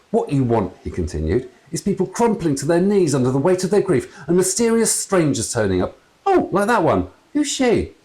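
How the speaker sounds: rising bewildered dad; puzzled ghost father. A narrator's reading. Father excited.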